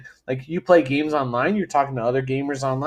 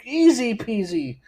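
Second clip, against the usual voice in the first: mock ad read; 7 semitones higher than usual. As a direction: sell it like a chirpy sponsor read. Parody product ad read.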